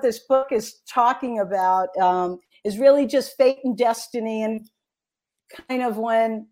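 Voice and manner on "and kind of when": ordinary delivery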